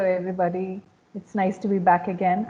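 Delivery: ordinary delivery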